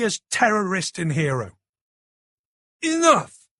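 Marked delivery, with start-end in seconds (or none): 2.8-3.5 furious cry. A narrator's reading. Enraged outburst at the audience.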